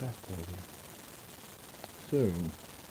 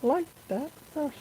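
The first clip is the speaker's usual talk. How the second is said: high mock voice; 9 dB louder than usual. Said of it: "I forget to go".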